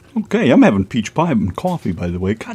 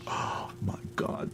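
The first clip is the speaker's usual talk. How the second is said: whisper; 22 dB quieter, slower than usual. Real, not acted. Craving homemade shit on shingle.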